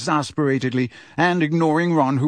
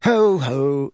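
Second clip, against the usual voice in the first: bored. A narrator's reading. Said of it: "in a bored voice".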